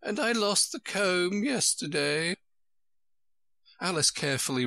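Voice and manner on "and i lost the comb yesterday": high, woeful royal voice; high-pitched, helpless lament; sighing Queen. A narrator's reading. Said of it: "the Queen said with a sigh".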